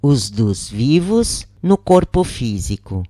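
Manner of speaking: ordinary delivery, nothing marked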